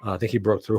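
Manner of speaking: usual speaking voice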